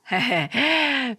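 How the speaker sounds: a short high mocking laugh; scornful mother's laugh. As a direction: laugh down at him.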